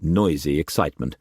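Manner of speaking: plain reading voice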